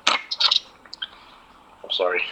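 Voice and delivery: distant, barely voiced; apologizing from far off; far away. Real, not acted. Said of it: "you sound like you're far away".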